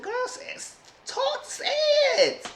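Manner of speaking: very high, strained cry of say it; squealing urging. Retold or imitated. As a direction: push her to talk in a squeak. Urging Sarah to speak.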